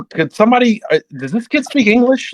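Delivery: high-pitched mock despair; retold exasperation. Team boss giving up on the rookie, exasperated. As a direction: appeal to the room in high exasperation.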